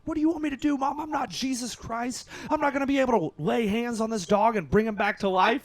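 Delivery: high-pitched exasperated yelling, acted out; high, worked-up mock tirade; retold screaming. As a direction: reenact yelling at your mom in a panic. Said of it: "I start screaming at my mom".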